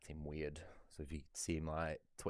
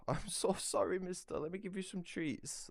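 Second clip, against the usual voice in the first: pet talk; 14 semitones higher than usual. Real, not acted. Owner apologising to cat after medicine, high cooing pet voice. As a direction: coo sorry to the cat in a high voice.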